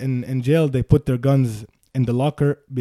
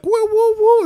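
alarmed high call; 6 dB louder than usual. Retold or imitated. Cop calling out.

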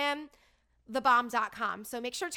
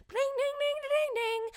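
very high, sing-song slot machine chimes; high chiming "ding, ding". Retold or imitated. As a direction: mimic casino machines ringing. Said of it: "Casinos are loud".

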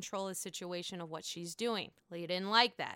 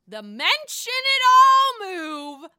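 high, loud, slow proclamation; hyped announcement; 11 semitones higher, 16 dB louder, slower than usual. Real, not acted. Vlogger announcing Leah's signature move, high and loud. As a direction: announce it like a big reveal.

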